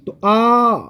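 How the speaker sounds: आ with the mouth wide open; vowel demo. Shown as a demonstration. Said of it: "इसमें से आ".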